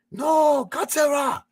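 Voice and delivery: high, loud hammed-up scream; dubbed monster-movie cry. Imitating an old dubbed monster movie, hammed-up high cry. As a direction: yell it like an old dubbed film. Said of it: "the mouth is off sync from the audio".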